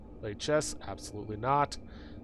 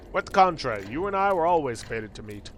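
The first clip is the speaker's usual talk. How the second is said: grand, portentous cosmic being; End of Time; 2 semitones higher, 4 dB louder than usual. Acted. Mysterious being greeting the lost player, grand portentous voice. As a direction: announce it as fate, opening loud.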